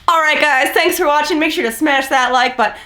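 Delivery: mocking his instant switch to a peppy YouTuber sign-off; upbeat YouTuber outro. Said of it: "and then went".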